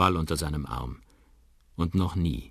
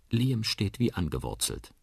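plain reading voice

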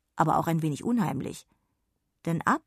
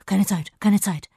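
louder, busy fairy voice; hurried fairy; 2 semitones higher, 8 dB louder than usual. Acted. Sausewind's hasty reply to Jonna, hurried. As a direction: brush her off in a hurry. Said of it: "zur Antwort".

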